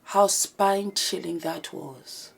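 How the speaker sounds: breathy whisper recounting a scary encounter, slow at times; hushed whispered storytelling. Acted. Telling viewers what happened to her and Karine, in a tense whisper.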